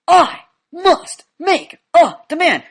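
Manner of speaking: high, loud, slow word by word; stilted pretend authority; mustered-up energy. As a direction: play-act a demand with pumped-up energy. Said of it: "I'm gonna muster up energy".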